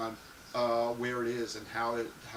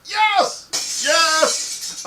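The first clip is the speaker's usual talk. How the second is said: much higher, much louder repeated yes; cheering yes; 11 dB louder, much slower than usual. Real, not acted. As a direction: cheer it out, building. Cheering for thigh highs.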